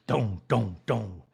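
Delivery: low spoken dongs; low, evenly spaced mock drum hits; imitated drum beats. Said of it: "beating a buffalo skin drum".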